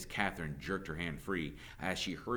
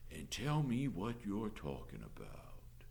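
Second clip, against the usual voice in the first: soft, slow Southern drawl; 6 dB quieter, slower than usual. A narrator's reading. Sheriff asking the teacher what happened, slow Southern drawl. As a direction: take your time, drawling lazily. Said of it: "in a slow drawl".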